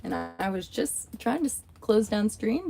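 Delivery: usual speaking voice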